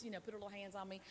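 normal talking voice

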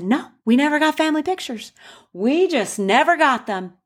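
high, insistent retelling of a tipsy relative; tipsy Trixie. Retold or imitated. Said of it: "She said".

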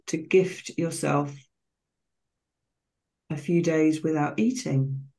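slow, calm meditation voice with long pauses between phrases; guided meditation. Real, not acted. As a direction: guide listeners gently into relaxation, phrase by unhurried phrase.

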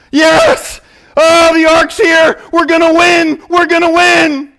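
high, loud, far-off cheering voice; mock cheering crowd. Israelites in camp at the ark, cheering high and loud. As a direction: cheer like a crowd sure of winning.

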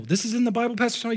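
high, incredulous listener's question; mock astonished churchgoer; surprised question.

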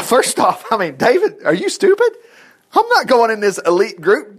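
high-pitched, laughing disbelief; incredulous objection. Acted. A would-be volunteer questioning David's plan, incredulous. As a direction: balk at the idea, pitch leaping up.

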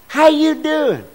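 high, bright mock greeting; mock cheery greeting. To churchgoers, a high mock-cheery how you doing. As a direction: toss off a fake cheerful hello.

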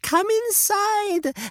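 inside swoops very high and slow; sing-song invitation. Acted. Witch lures the children, high sing-song. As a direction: lift come inside into a high sugary swoop. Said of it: "said the old lady".

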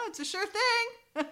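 mock salesman pitch.

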